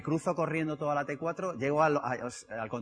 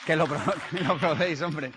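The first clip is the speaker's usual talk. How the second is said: laughing; 5 dB louder than usual. Real, not acted. Laughs at own joke.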